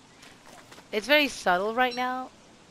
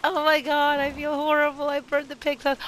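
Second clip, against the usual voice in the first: guilty dismay; 7 semitones higher, 7 dB louder than usual. Real, not acted. Guilt after burning house.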